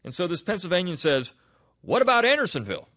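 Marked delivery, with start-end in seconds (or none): 1.8-2.9 high, loud challenging question; confrontational. Retold or imitated. Pennsylvania professor confronting a Southern writer about prisons, confrontational. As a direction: throw it out as a sudden challenge. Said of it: "has, uh, confronted her about".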